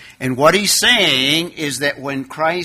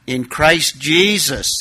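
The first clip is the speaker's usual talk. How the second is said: ordinary delivery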